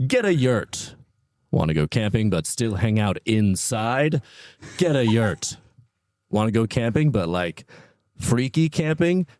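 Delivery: upbeat sponsor ad voice; ad read. Acted. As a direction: sell it like a radio ad. Reads a mock sponsor ad.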